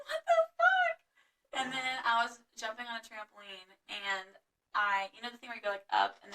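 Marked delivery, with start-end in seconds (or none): 0.1-1.6 high, drawn-out disbelief; shocked outburst. Retold or imitated. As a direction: stretch it out, high and loud. Retelling shock at being stung.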